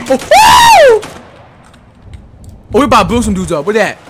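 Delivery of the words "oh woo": high, loud whoop; excited whoop. Real, not acted. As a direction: whoop with joy. Gamer celebrating a grab.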